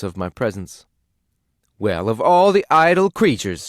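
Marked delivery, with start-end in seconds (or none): 1.8-3.7 loud, high scolding outburst; shrill-pitched nag at full volume; indignant exclamation. A narrator's reading. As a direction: scold him like a fed-up wife. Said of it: "she exclaimed".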